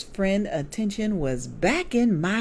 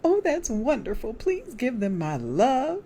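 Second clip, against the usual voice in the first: put-on high, beaming elderly woman; sweet widow sending love, smiling and much higher; bright old lady voice; 9 semitones higher, 3 dB louder than usual. A narrator's reading. Widow friend hears the girls are grown, beaming. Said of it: "she said with the brightest smile".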